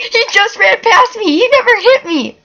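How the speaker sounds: high-pitched excited yelling; excited shriek. Real, not acted. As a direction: squeal with disbelief. Seeker runs past hiding spot.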